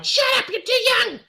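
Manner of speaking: high, fast, dismissive shushing; mom shushing. Retold or imitated. Mother brushing off her curious son, high and fast. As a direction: brush the kid off in a squeaky rush. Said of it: "My mom really talks like this".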